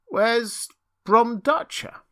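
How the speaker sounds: high, slow, loud searching question; bewildered old Rip. A narrator's reading. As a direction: ask anxiously after a lost friend. Rip asks after another old friend.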